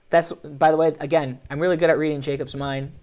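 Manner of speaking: usual speaking voice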